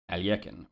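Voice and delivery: Alyekin as al-YEK-in; first pronunciation variant. Shown as a demonstration. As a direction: say Alyekin with 'yek' in the middle. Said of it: "any one of those three ways".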